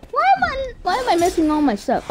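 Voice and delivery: high, fast exasperated whine; exasperated disbelief. Real, not acted. Player missing every attack, high exasperated outburst. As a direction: pitch leaps up in frustrated disbelief.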